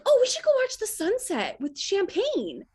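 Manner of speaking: high, sing-song excited pitch; high bright sing-song suggestion. Retold or imitated. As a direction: lure him to the sunset. Steering the proposal plan.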